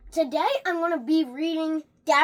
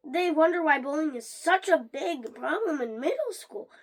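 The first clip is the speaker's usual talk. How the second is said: usual speaking voice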